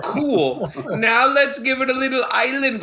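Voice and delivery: cheesy Jamaican lilt with a laugh; bad Jamaican accent. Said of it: "I'm talking like a Jamaican, like a bad Jamaican accent".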